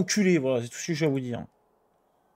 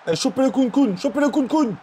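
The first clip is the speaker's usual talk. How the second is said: yelling from the sidelines; 10 semitones higher, 4 dB louder than usual. Real, not acted. Urging players on.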